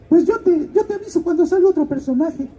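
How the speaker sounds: high put-on voice of a godmother. Godmother promising to call the actor, in a high put-on voice. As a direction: promise it in a high-pitched caricature. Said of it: "el caso es que me dijo".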